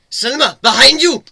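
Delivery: high, loud urgent cry; shouted warning. A narrator's reading. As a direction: yell to warn her. Warning Selma of the plant.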